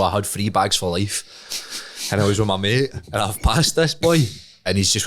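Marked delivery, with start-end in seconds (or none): none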